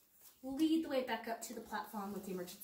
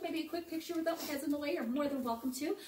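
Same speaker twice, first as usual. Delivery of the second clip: usual speaking voice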